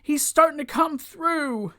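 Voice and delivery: high-pitched pretend worry; mock concern. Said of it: "Owen's starting to show concern".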